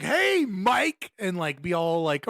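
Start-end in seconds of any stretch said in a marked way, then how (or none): 0.0-1.1 higher, louder mock hey rising at the end; mock chummy hello; overly familiar greeting. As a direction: imitate a pushy stranger acting like a buddy. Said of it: "it's super overly familiar".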